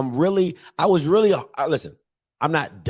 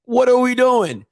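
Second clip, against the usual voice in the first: louder, higher, stressing are; exasperated; 9 semitones higher, 5 dB louder than usual. Real, not acted. Host scolding the defense, louder exasperated. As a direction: demand it, fed up.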